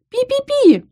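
rapid high squeaking mouse; mouse squeaks. Acted.

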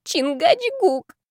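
very high, drawn-out proclamation; slow, theatrical high call; grand revelation. A narrator's reading. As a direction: announce it like a drunken revelation. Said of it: "Я вскинула голову и дала откровение".

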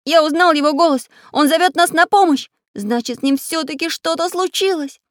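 high, loud, urgent; excited cry. A narrator's reading. Самоделкин rallying friends to a rescue, urgent cry. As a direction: call out in alarm. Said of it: "закричал Самоделкин".

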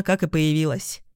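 ordinary delivery, nothing marked

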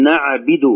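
نعبد mispronounced "na'bidu"; kasra on ba. Shown as a demonstration. Teacher imitating untaught reciters, نعبد with i for u. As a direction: put an i after the b in نعبد. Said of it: "يكسر الباء".

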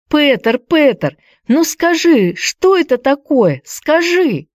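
put-on high, insistent girl's demand. A narrator's reading. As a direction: insist he tell her. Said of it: "требовала Хайди". (настойчивое требование девочки)

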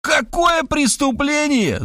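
high, slow frantic shriek. Acted. Writer shrieking at the detective, beside himself. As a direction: yell it in a voice not your own. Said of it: "завопил я".

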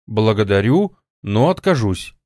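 usual speaking voice

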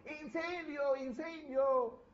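high, loud yell of fire, the second call slow and stretched; retold panicked shout of a boy; alarmed shout. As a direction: cry out the alarm to wake the house. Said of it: "cuando gritó diciendo".

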